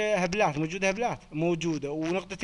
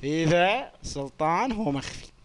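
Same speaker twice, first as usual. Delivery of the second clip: slow dictation; slower than usual. Shown as a demonstration. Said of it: "اخلص علي الله".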